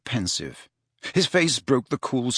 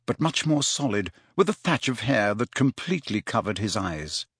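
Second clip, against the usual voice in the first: usual speaking voice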